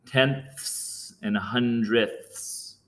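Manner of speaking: "tenths and hundredths" slow, hissing the final "ths" like a snake; drawn-out s like a snake. Shown as a demonstration. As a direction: stretch the "ths" in "tenths" and "hundredths" into a long hiss. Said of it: "sounding like a snake there at the end".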